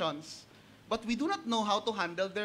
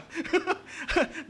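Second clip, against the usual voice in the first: real high laugh; 8 semitones higher than usual. Real, not acted. Preacher laughs at own joke.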